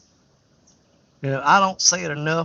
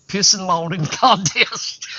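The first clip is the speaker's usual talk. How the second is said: words dissolve into laughter; slow, laughing delivery; 5 semitones higher, slower than usual. Real, not acted. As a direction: laugh through the punchline. Laughs through his joke.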